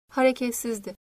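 usual speaking voice

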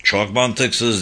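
ordinary delivery, nothing marked

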